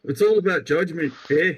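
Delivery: put-on an accent that drifts into Scottish by mistake; accidental Scottish accent. Joking podcaster aims for Canadian but lands Scottish, lightly accented. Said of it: "that was Scottish".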